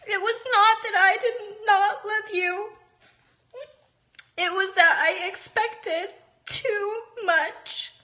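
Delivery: reading to her son while truly crying. Real, not acted. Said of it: "I can't believe I'm crying".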